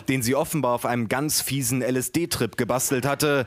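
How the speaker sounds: plain reading voice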